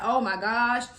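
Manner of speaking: trembling voice, teeth chattering; shaky, shivering words. Retold or imitated. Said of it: "sound like the girl from Titanic".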